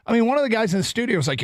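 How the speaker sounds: ordinary talk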